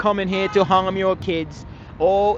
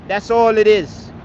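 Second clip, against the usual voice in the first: ordinary delivery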